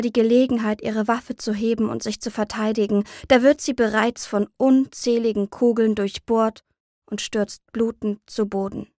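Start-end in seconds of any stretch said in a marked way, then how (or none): none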